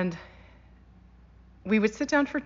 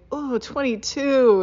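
higher, louder, slow gleeful count; cousin's count; 7 semitones higher, 7 dB louder, slower than usual. Retold or imitated. Cousin counting Nana's chews, slow gleeful. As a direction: savour the number, mock amazed. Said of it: "my cousin Melissa would say".

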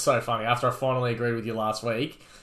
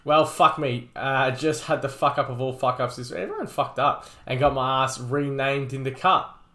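normal talking voice

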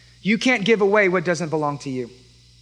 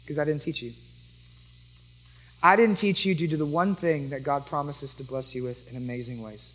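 sincere apology; 3 semitones lower than usual. Real, not acted. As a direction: drop the voice and own the fault. Pastor's quiet confession and apology.

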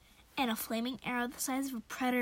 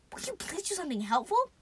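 higher, exasperated demand; exasperated, pitched-up demand; 5 semitones higher than usual. A narrator's reading. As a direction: plead and demand at once. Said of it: "I demanded".

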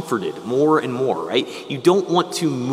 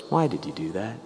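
softly voiced, lower question from the Lord; gentle reproach; 5 semitones lower, 8 dB quieter, faster than usual. The Lord to someone who hurt a loved one, gentle reproach. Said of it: "when our Lord says to us".

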